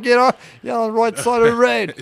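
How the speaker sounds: loud, high hooligan yell; British hooligan accent. Retold or imitated. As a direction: shout like a Euro Trip hooligan. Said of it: "the movie Euro Trip".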